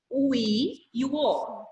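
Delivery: slowly naming each vowel, O i e o o; teacher slowly lists the vowels of mistério. Shown as a demonstration. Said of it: "olha lá".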